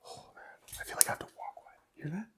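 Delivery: very soft frightened whisper; whisper, wanting to back off; scared whisper. Real, not acted. Scared, wants to leave.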